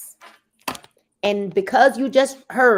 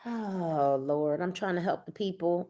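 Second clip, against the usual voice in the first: softer, hushed mock prayer to the Lord; mock pious plea; 5 semitones lower, 9 dB quieter than usual. Saleswoman mocking her own pitch as a hushed prayer. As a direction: sigh it out like a pleading saint.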